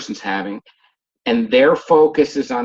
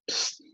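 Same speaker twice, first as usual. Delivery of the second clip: attention-getting psst; 14 dB quieter than usual. Shown as a demonstration. Demonstrates getting someone's attention.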